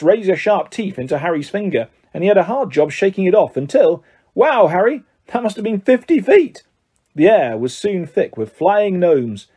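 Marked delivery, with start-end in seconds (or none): 4.3-6.6 impressed. A narrator's reading. Twin impressed by a throw.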